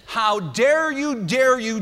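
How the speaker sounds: building outraged father; rising outrage. Retold or imitated. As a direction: climb on "dare". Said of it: "I'm sure he was saying things like".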